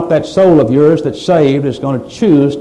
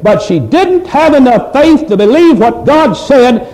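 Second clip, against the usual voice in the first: preaching at full force; 6 semitones higher than usual. Acted. Preacher pleads with congregation over Lot's wife, building fervor. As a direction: drive it home with rising fervor.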